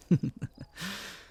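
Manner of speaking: a high short chuckle; teasing laugh; amused laugh. Acted. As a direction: laugh at how cute she is. Amused at her jealousy.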